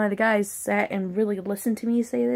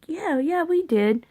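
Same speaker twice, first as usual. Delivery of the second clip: high, airy dismissive yeah; breezy; 9 semitones higher, slower than usual. Retold or imitated. Manager breezily claiming the head guy knew. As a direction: brush it off lightly. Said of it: "they were like".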